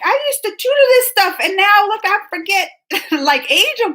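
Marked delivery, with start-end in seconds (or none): 0.1-2.7 exasperated self. Retold or imitated. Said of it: "I'm like".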